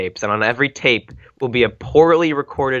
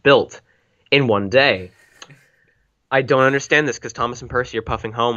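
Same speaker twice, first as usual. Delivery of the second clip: ordinary talk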